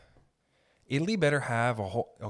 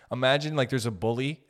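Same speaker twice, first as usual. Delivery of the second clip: normal talking voice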